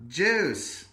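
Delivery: put-on curt kid demand. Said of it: "you would say".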